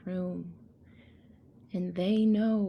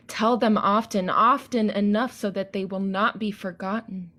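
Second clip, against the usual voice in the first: louder declaimed spoken-word; solemn spoken-word call; poem; 7 dB louder than usual. Acted. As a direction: proclaim it to the audience. Declaims the second act.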